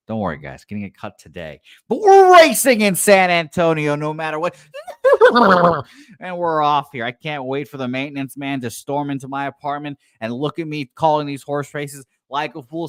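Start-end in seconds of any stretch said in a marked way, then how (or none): 1.9-12.9 higher, loud play-by-play opening; horse race call. Pretend. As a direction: launch the race like an excited track announcer.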